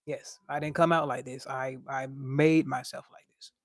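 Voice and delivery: ordinary talk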